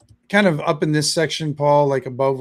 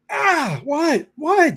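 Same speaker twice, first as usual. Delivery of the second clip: much higher, yelped "what, what"; startled yelp; 12 semitones higher, 3 dB louder, much slower than usual. Acted. Halfling boy smacked with a sword, startled high yelp. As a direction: squeal as if just smacked.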